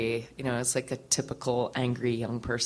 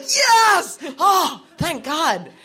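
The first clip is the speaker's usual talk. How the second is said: shouted, much higher and louder, then slow relief; overjoyed shout; 10 dB louder, much slower than usual. Retold or imitated. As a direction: cry out in joyful relief. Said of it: "and I was so happy".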